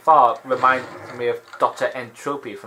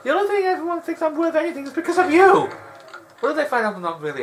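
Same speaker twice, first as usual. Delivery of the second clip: much higher, strained cartoon voice; Aladdin upset; faster than usual. Aladdin blaming the genie for his fame, in a high upset voice. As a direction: play a frustrated young hero complaining.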